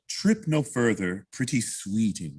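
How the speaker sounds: slow, drawn-out sweet talk; slow, charming coaxing; cute and charming. Shown as a demonstration. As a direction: woo her slowly, word by word. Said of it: "I wanna hear the cuteness".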